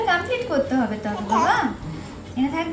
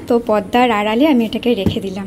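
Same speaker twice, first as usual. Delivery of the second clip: ordinary talk, nothing marked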